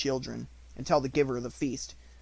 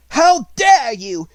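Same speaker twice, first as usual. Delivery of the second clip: very slow, much higher, much louder outraged cry; furious cry; 12 semitones higher, 14 dB louder, much slower than usual. A narrator's reading. Headmistress discovering the maid hiding, slow furious outcry. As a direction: hurl the words at her. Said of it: "she cried out".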